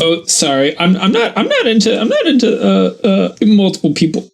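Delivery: high, giggling and flustered; flustered laughing. Pretend. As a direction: laugh it off awkwardly. Flustered reply in role.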